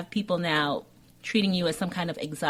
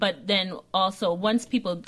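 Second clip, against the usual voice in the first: ordinary talk, nothing marked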